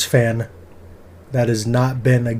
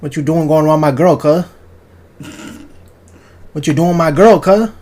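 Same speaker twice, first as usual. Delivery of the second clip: street tough voice; 3 semitones higher, 4 dB louder than usual. Retold or imitated.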